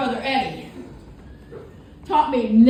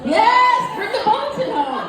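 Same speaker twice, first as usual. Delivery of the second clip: excited punchline; 9 semitones higher, 4 dB louder than usual. Acted. Answers own question to audience.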